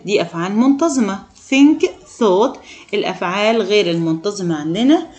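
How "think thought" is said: raised emphatic model. Shown as a demonstration. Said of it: "teacher stresses irregular verb forms".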